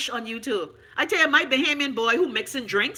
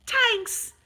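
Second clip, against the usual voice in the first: sarcastic thanks; 10 semitones higher than usual. Pretend.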